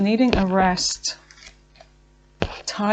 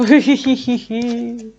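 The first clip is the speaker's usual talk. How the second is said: a sudden real laugh, louder; burst of laughter; 2 semitones higher, 4 dB louder than usual. Real, not acted. Reader laughing at her own slip, real burst of laughter. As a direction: laugh out loud at your own words.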